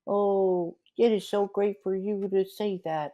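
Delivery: very high, squeaky puppet voice throughout; mock the fake-nice bachelor as a sugary Elmo. Co-host mocking Gary's fake sweetness, squeaky Elmo voice. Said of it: "I talk like Elmo".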